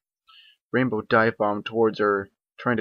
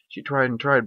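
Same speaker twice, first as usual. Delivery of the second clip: plain reading voice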